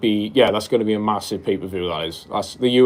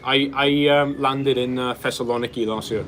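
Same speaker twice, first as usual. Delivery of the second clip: normal talking voice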